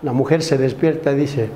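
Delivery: ordinary delivery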